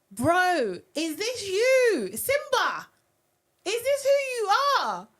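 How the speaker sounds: high, loud, slow and stretched; appalled disbelief. Real, not acted. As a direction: plead in disbelief. Appalled at Simba's behaviour.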